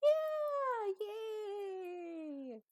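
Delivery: high, drawn-out hollow yay; mock cheer. [name] celebrates her own blunder, in a long mock cheer. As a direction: start loud and high, let the cheer trail away. Sarcastic cheer after admitting fault.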